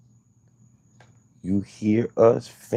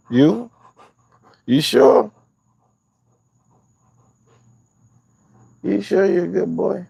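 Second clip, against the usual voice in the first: put-on high, sing-song praise for a good boy; excited talk to a puppy, much higher; doggy baby talk; 9 semitones higher than usual. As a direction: coo up at the dog, climbing ever higher. Doting baby talk to dog.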